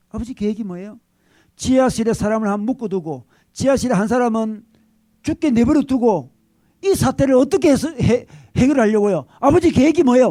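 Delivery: building to a loud, high demand; pressing question. Retold or imitated. Son presses his father, rising to a loud demand. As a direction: push harder and harder on the father. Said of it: "아들이 자기에게 묻습니다".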